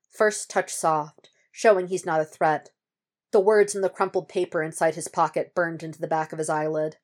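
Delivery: ordinary delivery, nothing marked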